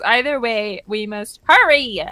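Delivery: normal talking voice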